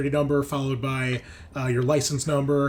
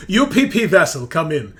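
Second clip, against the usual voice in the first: raised, loud comms call; radio hail; 5 semitones higher, 9 dB louder than usual. Acted. Drabikowski calls the ship on her comms, loud hail. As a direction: hail the vessel over the radio. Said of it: "she's on her comms and she's like".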